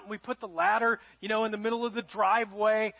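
usual speaking voice

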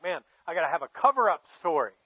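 hammed-up alarm turning into a bold plan; acted freak-out. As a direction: play a man scrambling for a cover-up. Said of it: "he starts to freak out".

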